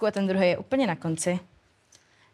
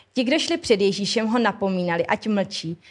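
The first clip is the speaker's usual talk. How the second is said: usual speaking voice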